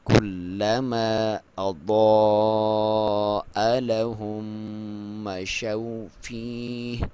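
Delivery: slow drawn-out tajweed chant; Quran recitation. Shown as a demonstration. Teacher recites the verse for students, slow chant. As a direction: recite it slowly with full tajweed. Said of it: "ضاد کے اوپر مد واجب ہے".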